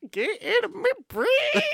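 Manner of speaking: high, slow, laughing; laughing squeal. Real, not acted. Laughing disbelief at shared thought.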